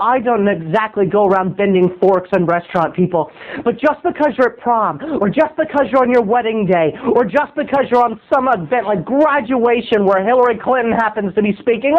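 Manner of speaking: ordinary talk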